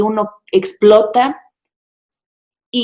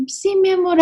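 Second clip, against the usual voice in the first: a much higher, sugary "yes, my love"; mock sweet agreement; 10 semitones higher than usual. Wife answering her husband, embarrassed, with a mock sweet yes. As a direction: give in sweetly while swallowing your pride. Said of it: "me sentí avergonzada".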